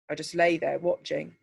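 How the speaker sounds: ordinary delivery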